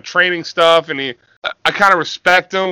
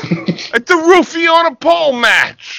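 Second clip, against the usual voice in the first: much higher, slow cartoon voice; mock meathead; 11 semitones higher, 3 dB louder than usual. Co-host as Vito naming his match, high and hammed-up. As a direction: pitch it like a dim wrestler.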